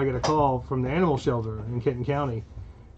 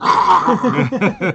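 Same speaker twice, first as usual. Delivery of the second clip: loud sudden mock snarl; imitated dog snarl; 4 semitones higher, 9 dB louder than usual. Owner imitating his dog when touched, loud snarling. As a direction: snap into a loud attacking-dog noise. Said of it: "as soon as you fucking touch him, he's like".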